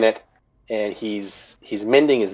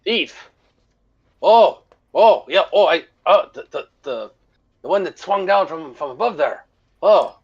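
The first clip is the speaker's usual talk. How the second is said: put-on high, stammering old fisherman; flustered fisherman; 5 semitones higher than usual. Fisherman stammering to Martin about the thief, high and flustered. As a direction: trip over the words as it dawns on him.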